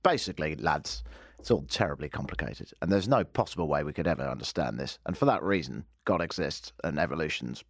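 a hint of a condescending politician, low and soft; lightly played pompous candidate; mock Carson paraphrase. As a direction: talk down to the voters, lightly voiced. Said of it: "I've boiled it down to this".

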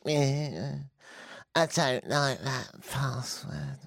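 slow, drawn-out machine grumble; talking computer. Pretend. Computer turning down a password, slow put-on voice. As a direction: play a computer dragging out its disapproval. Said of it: "bark at me".

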